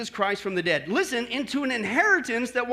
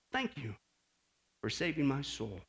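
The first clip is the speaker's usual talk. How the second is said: low, soft thanks to God; soft, intimate prayer voice; hushed prayer; 9 semitones lower, 9 dB quieter than usual. Shown as a demonstration. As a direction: pray it quietly and low. Models a private morning prayer.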